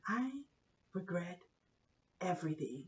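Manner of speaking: very soft, low, halting; defeated confession. Retold or imitated. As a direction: trail down into hushed regret. Confessing to husband after the ordeal.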